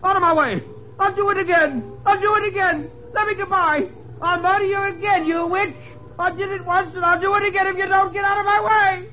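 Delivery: very high, frantic cries; panicked, strained screaming confession; hysterical shouting. A narrator's reading. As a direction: rave in desperate terror, pitch at the top. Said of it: "he shouted".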